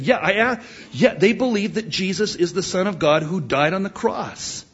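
high-pitched imitation of an insistent kid; eager child. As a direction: argue like an eager young son.

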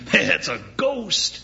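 high, startled mock fright; light imitated scare. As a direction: play at being spooked. We think it's a ghost.

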